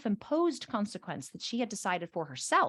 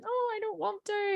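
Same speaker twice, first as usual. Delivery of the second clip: put-on high-pitched childish whine; imitated reluctant child; whining reluctant kid; 11 semitones higher than usual. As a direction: whine it like a child dodging a chore. Reluctant kids, acted.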